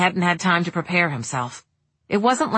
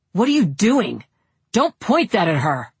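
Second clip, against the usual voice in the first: angry; 3 semitones higher than usual. Acted. Daniel confronts Kevin with gun.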